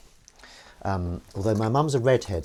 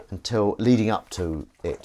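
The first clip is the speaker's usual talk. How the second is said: usual speaking voice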